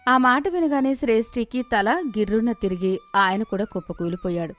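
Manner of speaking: ordinary delivery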